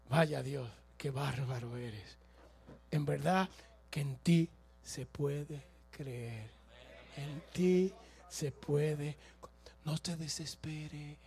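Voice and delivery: low, soft and slowed, almost intimate; hushed tender praise. Real, not acted. Preacher moved to tears, speaking softly of God's greatness.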